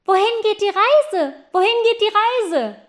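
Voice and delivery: high, loud call; factory girl calling up to the geese, high and loud. Acted. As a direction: lean out the window and call up. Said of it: "zum Fenster hinaus und rief".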